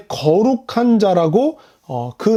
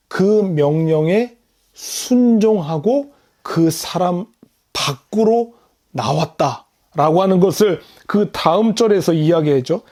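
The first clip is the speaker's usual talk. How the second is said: ordinary talk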